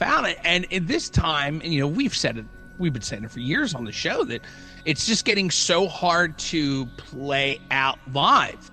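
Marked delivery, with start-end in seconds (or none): none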